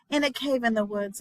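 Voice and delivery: ordinary delivery, nothing marked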